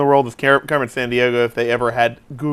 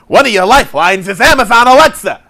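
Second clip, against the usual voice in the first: higher, louder quiz host announcement; mock game show host; 7 semitones higher, 6 dB louder than usual.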